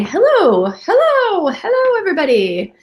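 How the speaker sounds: normal talking voice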